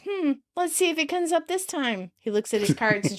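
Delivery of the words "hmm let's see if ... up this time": higher, mock-pondering sarcasm; lightly sarcastic musing. A narrator's reading. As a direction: pretend to wonder, sarcastically. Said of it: "Chandler sarcastically says".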